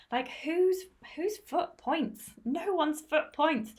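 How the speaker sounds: high, slow, incredulous rhetorical question; indignant disbelief. Real, not acted. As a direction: act baffled that shoes point. Mock outrage at pointy shoes.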